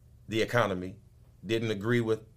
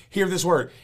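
preached louder and higher; 9 semitones higher, 6 dB louder than usual. Real, not acted. Preacher calling his congregation to listen. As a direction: lift it up, louder, into a call.

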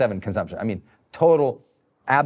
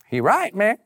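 a quick slangy bro voice, a bit higher; goofy put-on voice; 6 semitones higher than usual. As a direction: toss off a mock street-talk agreement. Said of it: "I don't know where that voice came from".

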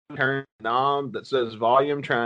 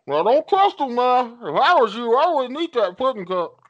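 much higher and louder, warning voice; Steve Harvey; 10 semitones higher, 5 dB louder than usual. Retold or imitated. Host imitating a TV host warning a friend, high and loud. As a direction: blurt a warning like a game-show host. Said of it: "he's like".

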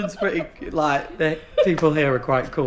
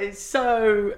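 ordinary delivery

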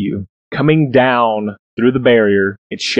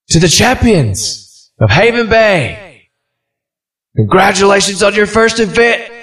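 announcer; 10 semitones higher than usual. Acted. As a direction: proclaim it to the stadium. Announcer voice addresses champions.

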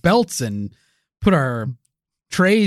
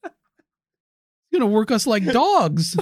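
mock complaint; 6 semitones higher, much slower than usual. Mock complaining about commitment.

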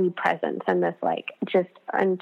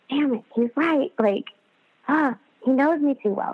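exasperated; 6 semitones higher than usual. Retold or imitated. Caught out, embarrassed by friend.

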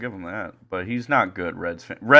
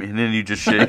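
ordinary talk

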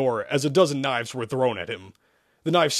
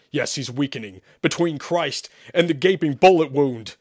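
louder, higher toward the end; urgent ordering; 5 semitones higher, 4 dB louder than usual. Acted. Priest needs a vessel for the demon, ordering urgently. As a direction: build to an urgent command. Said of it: "Sherman ordered".

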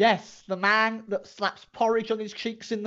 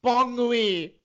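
put-on raised, far-from-convincing French accent; bad French accent; 6 semitones higher, 4 dB louder than usual. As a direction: bid goodnight in hammed-up French. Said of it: "do a French accent".

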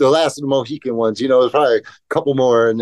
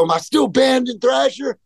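cocky challenge; 10 semitones higher than usual. Retold or imitated. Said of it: "I go".

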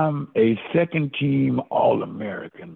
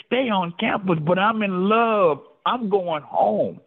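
high pleading whine; 7 semitones higher than usual. Retold or imitated. Young player begging his coach, high pleading whine. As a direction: pitch it up and plead like a lovesick kid.

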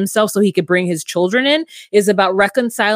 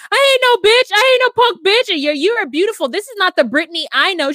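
sassy friend; 10 semitones higher than usual. Retold or imitated. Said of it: "she's like".

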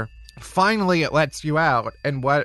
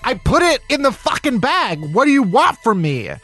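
raised, higher exasperated yell at the machine; exasperated shout; 7 semitones higher, 3 dB louder than usual. Acted. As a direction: yell back at the checkout in frustration. Frustrated shopper yelling at machine.

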